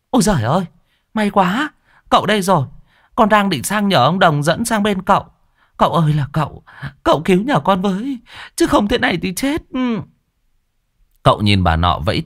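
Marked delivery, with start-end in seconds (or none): 0.1-9.6 put-on much higher, wailing old woman. Woman calls out to the master, high wailing. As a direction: wail with relief and fear. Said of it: "bà ấy hú lên".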